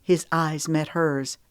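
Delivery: plain reading voice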